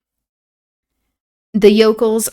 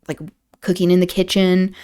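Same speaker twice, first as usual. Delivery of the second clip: ordinary delivery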